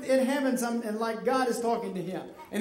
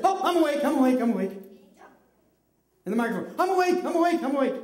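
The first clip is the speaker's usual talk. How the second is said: pretending to be awake; 3 semitones higher, faster than usual. Shown as a demonstration. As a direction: jolt awake and insist you're up. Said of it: "Now you have to say".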